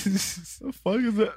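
slow, high shocked question; stunned, drawn-out disbelief. Retold or imitated. Retold shock at scratches.

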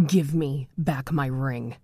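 low, slow, cold order; phantom command. A narrator's reading. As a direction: command like a vengeful ghost. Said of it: "the phantom commanded".

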